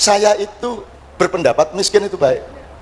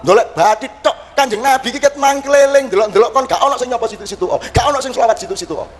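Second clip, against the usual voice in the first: put-on higher-pitched lamenting old man; mock tearful rant from a crying elder; old holy man wailing; 6 semitones higher than usual. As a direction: wail the complaint in a raised pitch. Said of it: "baru dia menjawab".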